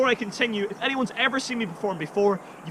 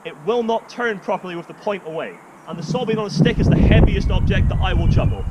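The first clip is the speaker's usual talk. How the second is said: ordinary talk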